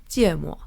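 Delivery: jiemo said as a Mandarin word; Chinese pronunciation. Shown as a demonstration. Host teaching the word for wasabi, Chinese pronunciation of jiemo. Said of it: "in Chinese, the pronunciation".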